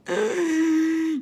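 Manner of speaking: sharp frightened gasp; stressed gasp. Shown as a demonstration. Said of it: "how do you feel when you're stressed".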